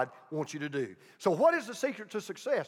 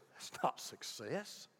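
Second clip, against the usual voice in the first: very soft, low and slow; gently but firmly set them straight as God; hushed voice of God overturning worldly success; God's quiet correction; 7 semitones lower, 14 dB quieter, slower than usual. Retold or imitated. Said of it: "God says".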